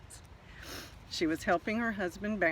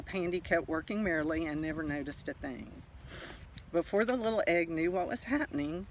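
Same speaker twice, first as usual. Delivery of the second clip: plain reading voice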